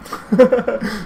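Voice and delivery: bursting high laugh. Real, not acted. Laughing at his own cheeky offer, high burst of laughter. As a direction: laugh at your own joke.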